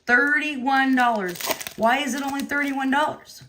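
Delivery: stunned by how cheap it is; incredulous disbelief. Real, not acted. Amazed at cheap prices.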